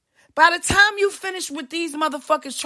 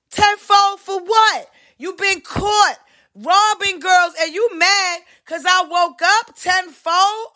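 higher, slower indignant retort; slow, raised indignant challenge; 6 semitones higher, slower than usual. Real, not acted. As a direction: demand an answer, outraged.

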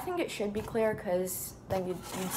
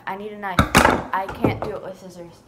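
louder, insisting; insistent; 9 dB louder than usual. Real, not acted. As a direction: demand it with frustration. Repeating demand, now louder.

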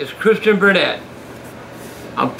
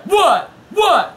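higher shouted "What?" said again and again; Stone Cold catchphrase; 8 semitones higher than usual. Acted. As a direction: fire "What?" back like a wrestler. Continuing the catchphrase.

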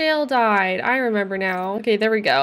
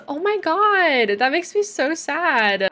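exaggerated sad; 6 semitones higher, slower than usual. Real, not acted. Reacting to sad Sim moodlet.

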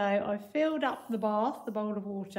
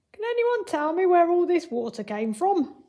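character voice; 8 semitones higher, 3 dB louder than usual. A narrator's reading. Archimedes voiced in story.

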